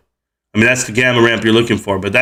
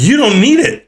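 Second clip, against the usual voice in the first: imitating the tax lady; 10 semitones higher than usual. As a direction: mimic her scolding tone. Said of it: "She's like".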